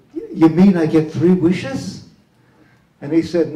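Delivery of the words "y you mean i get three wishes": louder, hopeful astonished question; acted amazement. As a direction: play eager disbelief at a lucky offer. Acts amazed dreamer meeting genie.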